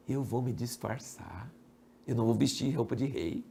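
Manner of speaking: low, hushed plotting; sly scheming. Acted. False king plotting his disguise, sly and hushed. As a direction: confide a sneaky plan, very softly. Acabe falso trama o disfarce.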